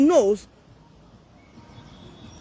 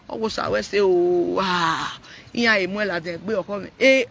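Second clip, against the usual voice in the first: ordinary delivery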